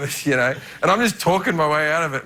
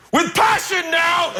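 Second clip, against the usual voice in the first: mock Pacino shout; 13 semitones higher, slower than usual. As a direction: drag it out like a director demanding passion. Pacino coaching the line.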